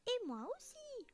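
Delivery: eager little bear chiming in; toute petite voix. A narrator's reading. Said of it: "de sa toute petite voix". (toute petite voix très aiguë)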